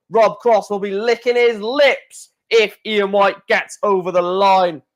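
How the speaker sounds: high, excited play-by-play call; live darts commentary. Real, not acted.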